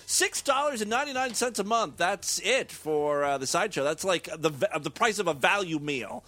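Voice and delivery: lively sales read with high, punched starts; energetic host plug; promotional pitch. Real, not acted. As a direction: sell the membership with big swoops in pitch. Host reads membership plug.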